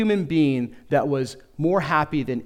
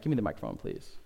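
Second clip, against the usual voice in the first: low, soft mock pastor mutter; hushed awkward aside; 14 semitones lower, 12 dB quieter than usual. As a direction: quietly ask for it back, acting embarrassed. Acting an awkward pastor wanting the mic.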